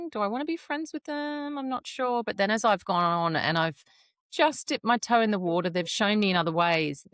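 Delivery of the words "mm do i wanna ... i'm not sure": hesitant doubt. Retold or imitated. Retelling her own doubtful inner thought.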